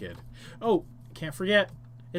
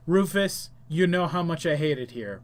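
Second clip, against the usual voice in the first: normal talking voice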